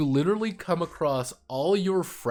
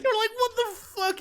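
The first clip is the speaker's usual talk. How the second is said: very high, slow squeal of disbelief; hammed-up high-pitched shock; mock disbelief; slower than usual. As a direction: squeak it out, drawn out and incredulous. Player's reaction to the betrayal.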